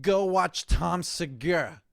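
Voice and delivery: loud message to mom. Real, not acted. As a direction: call it out to your mother. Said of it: "relay this message from you".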